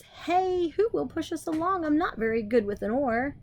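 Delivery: lightly voiced high cartoon cucumber; Larry's voice. Larry asks the crew for help, lightly voiced high. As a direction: pitch it up for the cucumber. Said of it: "Larry says".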